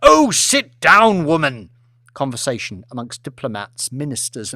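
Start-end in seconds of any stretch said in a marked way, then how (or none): 0.0-1.7 slow, loud, exasperated bellow; the husband finally snapping at his wife; furious shout. Acted. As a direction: lord loses his temper and roars at his wife. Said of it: "lost it".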